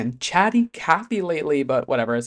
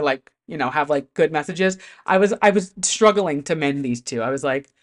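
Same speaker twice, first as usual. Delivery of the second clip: ordinary delivery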